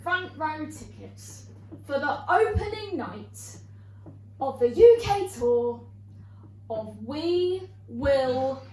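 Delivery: very slow, with suspenseful pauses; drawn-out reveal. Real, not acted. Comedian revealing which show she booked, slow and deliberate. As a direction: stretch each phrase toward the punchline.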